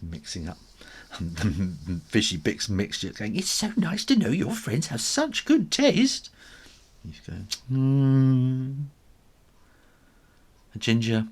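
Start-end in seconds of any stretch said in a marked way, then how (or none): 3.4-6.3 high, bright chatty mum voice; a proud mum, pleased; cheerful mum. Retold or imitated. Mum chatting proudly while cooking. 7.7-8.8 louder hesitant hmm; a guilty noncommittal hum; uneasy hum. Acted. Ginger's uneasy reply.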